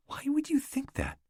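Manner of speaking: breathless high whisper of disbelief; stunned hushed question; shocked whisper. Acted. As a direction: gasp it out, hardly voiced. Catherine reacts in shock to Alec.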